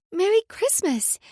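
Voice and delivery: put-on excited little girl. Excited child greeting.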